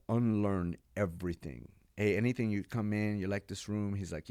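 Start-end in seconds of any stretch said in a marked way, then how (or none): none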